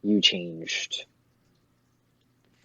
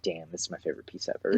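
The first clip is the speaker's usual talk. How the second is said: softer, lower, fast and matter-of-fact; a hushed, flat-toned damn of admiration; deadpan awe; 3 semitones lower, 7 dB quieter, faster than usual. Retold or imitated. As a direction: say it quietly, like it's no big surprise now. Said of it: "you're like".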